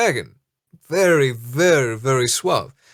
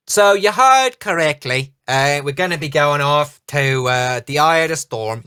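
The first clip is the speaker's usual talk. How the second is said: captain's announcement; 3 semitones higher, 4 dB louder than usual. Acted.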